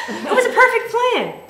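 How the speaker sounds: high, loud, laughing delight; gleeful triumph. Acted. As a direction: crow over the perfect plan.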